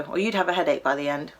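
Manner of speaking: ordinary delivery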